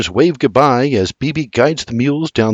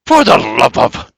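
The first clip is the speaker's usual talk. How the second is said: grumbling. A narrator's reading. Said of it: "he grumbles".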